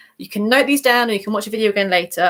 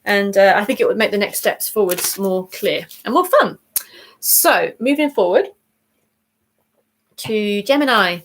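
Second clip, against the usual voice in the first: normal talking voice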